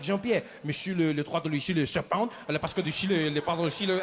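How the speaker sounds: imitation French father's chatter; improvised French gabble; mock French. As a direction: rattle off pretend French to a son. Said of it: "He turns to his son".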